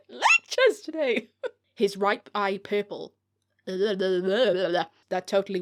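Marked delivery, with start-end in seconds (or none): 0.1-1.3 mock high-pitched. Shown as a demonstration. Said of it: "I went a bit high-pitched then".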